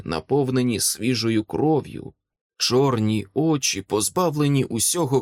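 plain reading voice

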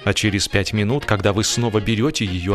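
ordinary delivery

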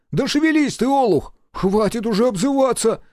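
high, loud exasperation; worked-up, high-pitched scolding; acted squabble. Quarrel between boss and mechanic.

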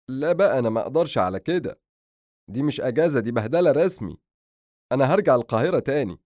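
exasperated, comic protest; fed-up younger brother. Acted. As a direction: burst in complaining, announce you quit. Brother bursts in complaining.

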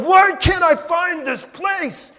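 high, drawn-out exasperated shout; acted-out car tantrum, loud and high; frustrated yelling. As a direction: yell it slowly at full voice. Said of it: "I'm yelling in my car".

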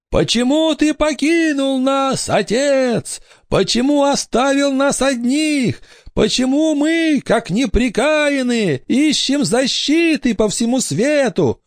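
very high, slow reproachful wail; ghostly lament. A narrator's reading. Ghost children reproach runaway father, high wailing lament. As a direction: wail accusingly like an abandoned spirit.

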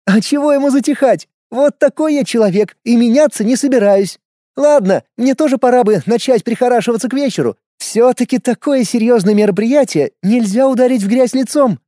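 a hint of a sly older woman; cheeky, knowing woman's voice; lightly voiced Jones. A narrator's reading. (лёгкий голос Джонс)

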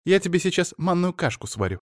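wife fussing over her husband, in a high chatter. Acted. Wife character voice.

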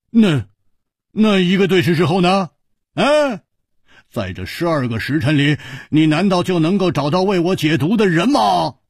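hearty hero urging everyone to drink; Hu Tiehua, reckless bravado. Acted. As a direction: shrug off death and call for wine. Hu Tiehua pressing Chu.

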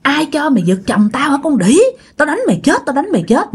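hammed-up furious insults, voice up high; mock cursing rage. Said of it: "vừa chửi vừa đánh". (giọng chửi rủa giả giận dữ, cao giọng)

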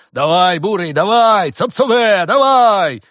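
very loud, high urging yells; booming shout. A narrator's reading. Farmer urging his ox along, booming yell. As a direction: holler to drive the beast on. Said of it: "вновь прозвучал зычный голос".

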